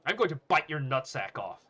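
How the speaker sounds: loud, heated outburst; angry threat. Acted. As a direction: blurt the threat forcefully. Student threatens the bully.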